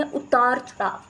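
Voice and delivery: ordinary talk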